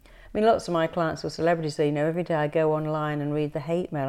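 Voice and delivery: ordinary talk, nothing marked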